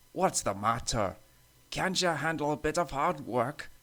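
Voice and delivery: taunting engine in a put-on voice; teasing. A narrator's reading. Duncan to the tired older engines, teasing. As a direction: needle them with a mock question. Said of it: "Duncan teased".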